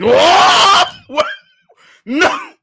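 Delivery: high, loud yelps of disbelief; shocked shouting. Real, not acted. Streamer reacting to the Shanks reveal, shocked shouting. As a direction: cry out in stunned shock.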